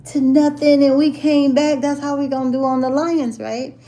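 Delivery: mock the Cowboys fan crowing about the comeback; boastful rival fan. Imitating the Cowboy fan's trash talk, cocky and loud. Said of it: "He was like".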